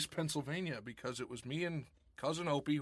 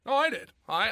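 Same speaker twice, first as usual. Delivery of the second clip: loud, high insistence that it was his; emphatic protest; 9 semitones higher, 10 dB louder than usual. Real, not acted. To Rob, claiming the joke, a loud high protest. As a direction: yelp it out, no, it was me.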